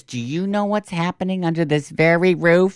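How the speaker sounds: high-pitched gossip-breaking question; hammed-up indignant reveal; mock scandalized. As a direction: play up a scandalized teen announcing news. Diana breaks the scandal, retold.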